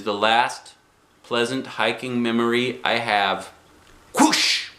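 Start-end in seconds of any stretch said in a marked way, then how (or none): 4.2-4.7 breathy rushing whoosh; whooshing noise. A narrator's reading. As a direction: make the sound of a bird zipping past. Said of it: "The noise zoomed by my ear".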